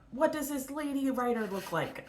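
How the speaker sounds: lightly put-on voice of a nosy critic; mock literary commentator. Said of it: "trope of literary commentary of the time".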